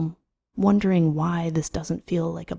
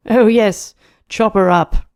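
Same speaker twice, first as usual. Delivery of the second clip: amused relish; 5 semitones higher, 7 dB louder than usual. Acted. Carnes recalls the joke, amused.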